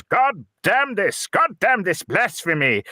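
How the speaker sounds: high, drawn-out mock curse; indignant vicar. Host voices vicar cursing the TV, high mock outrage. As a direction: act out a vicar's outrage in a put-on voice. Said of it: "this vicar goes and he goes".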